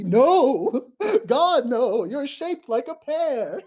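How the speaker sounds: high, loud, laughing mock rejection; mock ghost chorus. Streamer playing ghosts rejecting her, laughing. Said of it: "They all audibly".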